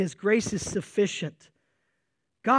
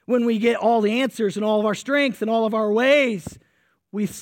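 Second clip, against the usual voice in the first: normal talking voice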